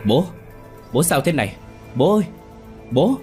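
high, frantic calling out to father; panicked shout. A narrator's reading. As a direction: cry out in panic for a dying father. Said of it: "Anh hét lên".

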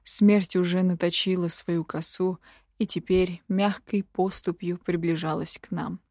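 ordinary delivery

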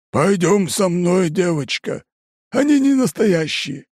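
slow, high overblown pathos; over-dramatic coaxing, laid on thick; hammy stage actor. A narrator's reading. Impostor continues the hammy pitch.

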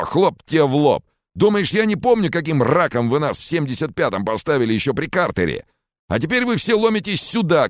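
high, loud furious rant; heated shouting tirade; enraged tirade. Acted. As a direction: rant at full pitch, outraged. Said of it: "рассвирепел Ханди".

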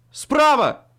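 очень громкий высокий крик-предупреждение; кричит, спасая от удара. Acted. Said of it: "крикнул я". (громкое предупреждение)

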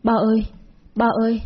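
high panicked calls for her son; a mother calling her lost child in panic; crying out while running. Acted. Mother runs out calling her missing son, high and frightened. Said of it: "vừa chạy vừa khóc và gọi".

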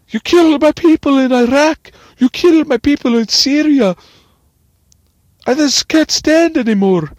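high, very loud screeched accusation; mock screamed rant. He calls in after the shooting, mock screamed rant. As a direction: shriek it out, hammed-up outrage. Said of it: "and he's like".

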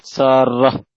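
slow سارة as Saarrah; doubled r. Real, not acted. Student reading on after correction, سارة with doubled r. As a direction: keep the "rr" long in سارة. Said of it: "بتشديد الراء".

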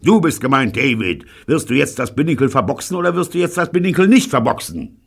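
high, loud raging shout; furious captain. Acted. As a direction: bawl out the sailor. Captain bawling at David. (wütend brüllend)

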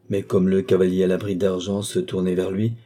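usual speaking voice

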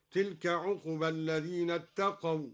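ordinary delivery

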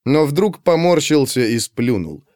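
ordinary delivery, nothing marked

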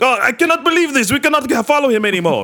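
put-on high Russian accent, cannot believe it; exasperated Russian soldiers.

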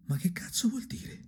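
usual speaking voice

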